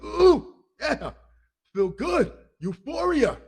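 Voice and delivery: high, swooning ooh of pleasure; blissed-out sugar rush. Retold or imitated. To the audience about the honey buns, a high swooning ooh of bliss. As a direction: savor it like pure euphoria. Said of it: "you're like".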